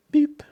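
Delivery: short, high-pitched "beep"; imitated beep. Host beeping before opening the phone lines, high imitated beep. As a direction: mimic an electronic beep, high and quick.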